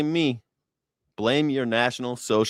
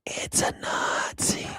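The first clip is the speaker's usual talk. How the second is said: whispering; 7 dB quieter, slower than usual. Real, not acted. Punchline dropped to whisper.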